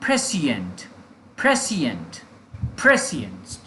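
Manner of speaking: "प्रेसिएंट" as PRE-si-ent, each repeat falling; pronunciation drill. Shown as a demonstration. Teacher modelling pronunciation for learners, "प्रेसिएंट" repeated with a firm fall. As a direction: drill "प्रेसिएंट" with a punched first syllable. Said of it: "इसका pronunciation है".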